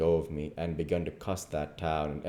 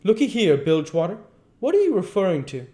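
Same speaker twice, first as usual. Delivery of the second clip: loud, high-pitched demand; ruffled indignation; 13 semitones higher, 9 dB louder, faster than usual. A narrator's reading. King challenging the duke's hint, ruffled indignation. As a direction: bristle and demand an explanation. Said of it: "kind of ruffles up and says".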